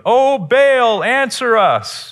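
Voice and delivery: high, loud pleading cry; mock scream to Baal. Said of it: "are all screaming at the top of their lungs".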